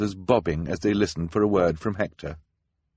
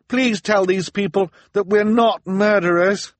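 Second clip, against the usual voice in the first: high, loud, desperate appeal; pleading call; 7 dB louder than usual. A narrator's reading. Violet appeals to Hector before the mob, pleading cry. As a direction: beg for someone to speak up. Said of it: "Violet called".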